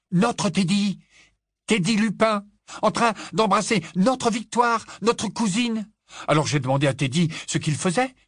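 put-on high loud thrilled boy; excited gossip. A narrator's reading. Boy telling adults big gossip, high excited voice. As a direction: burst out with big news.

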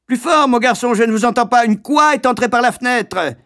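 high, loud hectoring master; bully the boy to speak up; shouting schoolmaster; loud demand. Acted.

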